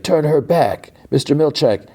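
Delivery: high, insistent retold demand; calling out. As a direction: imitate a demand called to the boss. Irving yells demands up to boss.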